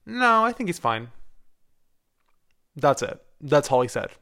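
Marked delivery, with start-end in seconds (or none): 0.1-1.1 dismissive doctor voice. Retold or imitated. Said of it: "my pediatrician was like".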